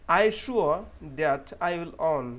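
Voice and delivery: loud, very slow reading; slow model sentence. Shown as a demonstration. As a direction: stress each word for learners. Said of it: "यहाँ पे definitely वो sound आया". (धीमा, ज़ोर से पढ़ा वाक्य)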